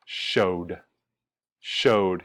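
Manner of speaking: "showed" in one syllable with voiced "d"; D ending demonstrated. Shown as a demonstration. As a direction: say "showed" like "shode", distinctly. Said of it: "the pronunciation is showed".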